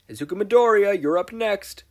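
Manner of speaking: loud, high call across the room. Acted. Clone calling Izuku to present, loud. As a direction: summon the next applicant. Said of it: "called one of Ectoplasm's clones".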